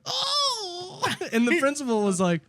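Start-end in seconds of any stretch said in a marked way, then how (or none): 0.1-1.0 very high, mock accent wail; mocking Eric. Student re-enacting the taunt for principal, mocking accent. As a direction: squeal it as an accent caricature. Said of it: "I walked up to Eric and I went".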